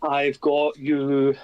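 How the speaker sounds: ordinary delivery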